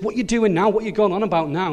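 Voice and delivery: high-pitched, hurried brotherly scolding; nagging older brother. Acted. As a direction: tell off your little brother.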